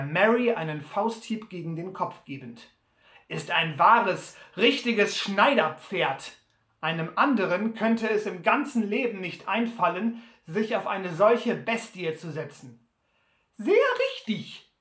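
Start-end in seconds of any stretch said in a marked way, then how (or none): 3.3-12.9 lauter, höhnischer Butler; Butler spottend. Acted. Butler verspottet das Maultier. 13.5-14.7 viel höher, langsam gedehnt als Sam Hawkins; Sam stimmt zu. Acted. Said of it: "stimmte Sam bei".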